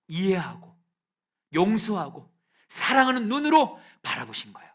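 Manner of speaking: high, loud ringing declaration; preaching shout. Real, not acted. Pastor proclaiming Jesus' forgiving gaze, loudly. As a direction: proclaim it at full force. Climax of sermon point.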